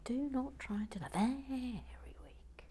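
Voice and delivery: teasing whisper. Pretend. Teasing Uncle Strawberry in a high, soft whisper. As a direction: taunt Uncle Strawberry under your breath. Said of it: "mock whisper".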